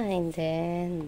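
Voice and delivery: normal talking voice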